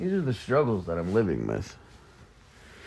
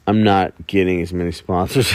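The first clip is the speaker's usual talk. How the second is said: ordinary delivery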